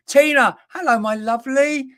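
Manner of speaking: high, loud, delighted hail; excited greeting. Real, not acted. Medium spots Tina's name pop up, excited greeting. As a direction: greet her loudly with a real burst of excitement. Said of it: "I get a bit excited".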